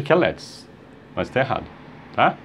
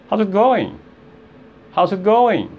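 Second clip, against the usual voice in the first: quick, contracted, higher pitch; fast demo; 6 semitones higher, slower than usual. Shown as a demonstration. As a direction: run the words together briskly. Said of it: "quando você fala rápido, aí sai". (rápido, emendando as palavras)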